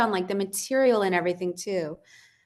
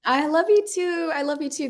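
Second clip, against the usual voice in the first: gushing affection; 8 semitones higher than usual. Real, not acted. Guest returning the designer's love at goodbye, gushing. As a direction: coo it high and fondly.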